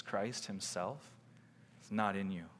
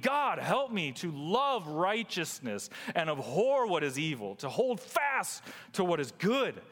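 raised, ringing sermon voice; full-force preaching; 12 semitones higher, 5 dB louder than usual. Real, not acted. Preacher proclaiming forgiveness to the congregation, impassioned preaching. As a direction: proclaim it to the congregation, pitched high.

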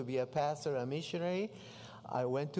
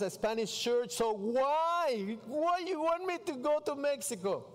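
building protest; 4 semitones higher than usual. Retold or imitated. Missionary arguing with God about leaving for Mexico, protesting. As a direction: start reasoning, end in high exasperated pleading.

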